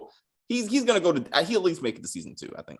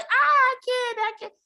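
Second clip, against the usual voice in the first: very high mock wail; exaggerated high-pitched helpless cry; imitating Julie. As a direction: squeal it as a hammed-up imitation. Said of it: "And Julie's like".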